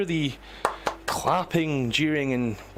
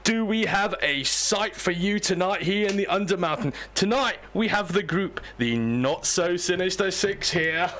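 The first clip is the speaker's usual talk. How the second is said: higher, rolling showman's welcome; showy game show host; 4 semitones higher than usual. Acted. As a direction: greet the whole audience like a TV host.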